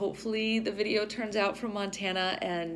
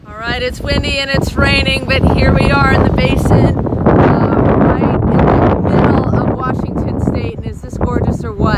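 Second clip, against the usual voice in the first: loud, raised voice over wind and rain; shouting over the wind; 8 semitones higher, 21 dB louder than usual. Real, not acted. Vlogger outdoors in wind and rain, shouting to camera. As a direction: project hard to be heard outdoors.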